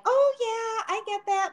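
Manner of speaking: high-pitched, sweetly agreeable imitation; sweet agreeing. Client imitating her sweet shampooer, high and agreeable. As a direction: lift it high, like an angelic stylist sympathizing. Acting out the angelic stylist agreeing.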